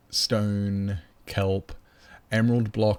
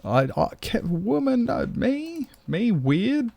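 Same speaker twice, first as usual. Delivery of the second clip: mock indignant; 13 semitones higher, slower than usual. Mock offended at chat remark.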